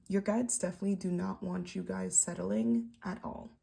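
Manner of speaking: ordinary delivery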